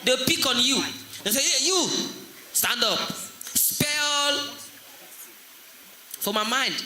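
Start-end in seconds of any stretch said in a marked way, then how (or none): 1.4-4.6 lightly voiced teacher's command, last word held high; a hint of a teacher picking on a pupil; strict teacher.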